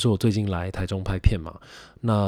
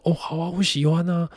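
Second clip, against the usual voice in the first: eager; 10 semitones higher than usual. Retold or imitated. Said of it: "然后我就说".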